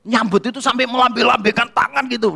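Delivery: ordinary delivery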